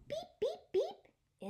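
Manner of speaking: squeaky high toy-horn beeps; sing-song high beep beep beep. Acted. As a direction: beep like a tiny truck horn, bright and high. Toy truck honks in story.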